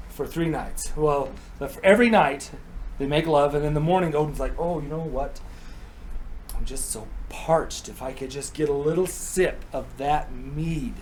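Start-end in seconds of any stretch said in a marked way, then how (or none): 4.6-10.9 coaxing, feigned thirst. Acted. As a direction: plead gently, stretching the words. Odin coaxing the guardian for mead.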